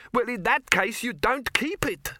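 high-pitched dwarf explainer; high, deliberate know-all; expansive dwarf. A narrator's reading. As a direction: begin a long explanation with relish. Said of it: "in the expansive manner of one about to".

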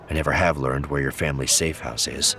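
ordinary delivery, nothing marked

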